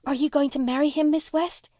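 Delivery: put-on high, quick child's question; high-pitched, hurried eagerness; eager. A narrator's reading. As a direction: burst out hopefully in a little girl's voice. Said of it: "asked Una eagerly".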